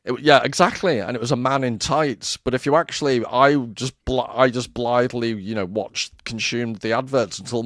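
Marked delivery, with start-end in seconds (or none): none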